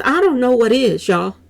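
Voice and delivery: ordinary talk, nothing marked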